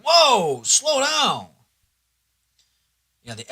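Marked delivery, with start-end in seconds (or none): 0.0-1.5 sudden high, loud mock alarm; startled whoa. Scrolling and suddenly shocked by porn, a mock yelp. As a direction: jolt back as if caught off guard. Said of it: "You're like".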